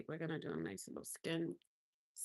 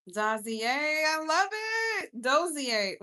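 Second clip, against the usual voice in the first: gushing over name; 6 semitones higher, 9 dB louder, much slower than usual. Real, not acted. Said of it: "Dozier is even better than anything".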